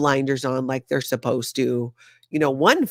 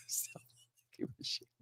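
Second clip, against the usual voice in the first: barely audible through laughter; helpless laughing; 7 semitones higher, 20 dB quieter, faster than usual. Real, not acted. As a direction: repeat it weakly while cracking up. Cracks up repeating a comment.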